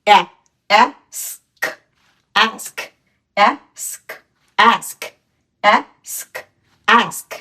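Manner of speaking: অ্যাস্ক repeated slowly with a short open a; teacher modelling a word for students to copy; drilled pronunciation. Shown as a demonstration. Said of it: "ওর উচ্চারণ কি হবে".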